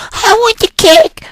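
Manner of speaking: put-on very high, squealing child's cry; whiny kid demand. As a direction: squeal it like a bratty little kid.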